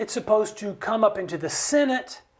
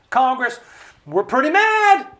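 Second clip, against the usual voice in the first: much higher, louder fighting-mad cry; mock angry shout; 6 semitones higher, 6 dB louder than usual. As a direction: voice the mad public shouting at Congress. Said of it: "fighting mad".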